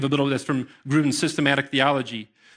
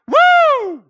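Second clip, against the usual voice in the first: much higher, much louder joyful woo; celebratory whoop; 10 dB louder than usual. Shown as a demonstration. Preacher shows the congregation how to celebrate, whooping. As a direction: whoop like a fan in the end zone. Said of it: "that's the way we need to be celebrating".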